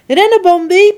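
high, loud urgent order; commanding. Acted. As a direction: order him to run for his life. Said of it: "beveelt mama".

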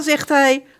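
ordinary delivery, nothing marked

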